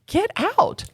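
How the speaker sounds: high-pitched, retold "no way" reaction; acted-out incredulous delight; amazed disbelief. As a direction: exclaim it way up high, astonished. Retelling amazement at free hours.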